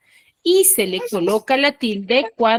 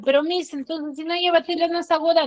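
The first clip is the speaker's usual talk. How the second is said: higher-pitched, quick student protest; objecting pupil; 7 semitones higher, faster than usual. Acted. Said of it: "Dirán".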